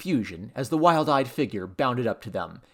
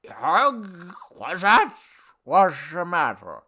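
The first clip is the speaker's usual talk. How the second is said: put-on slurred, slow, higher-pitched drunk; drunken god; 5 semitones higher, 4 dB louder, much slower than usual. A narrator's reading. Drunk god interrupted mid-song, slurred. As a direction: slur it like a roused drunk.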